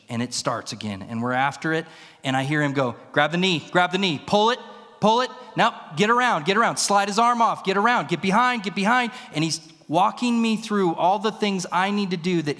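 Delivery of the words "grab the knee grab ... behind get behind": raised, punchy sideline commands; urgent coaching calls. Retold or imitated. Coach to wrestler mid-match, urgently calling out moves. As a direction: act out a coach calling moves over the noise.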